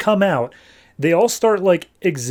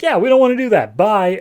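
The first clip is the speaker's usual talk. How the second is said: high cheeky robots; 7 semitones higher, 4 dB louder than usual. Retold or imitated. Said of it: "Metal Men are like".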